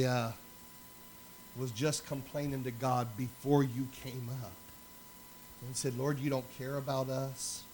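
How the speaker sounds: woman in tears confessing her doubt to the pastor, understated; retold weeping. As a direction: gently voice a crying woman confessing. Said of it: "she's just weeping profusely".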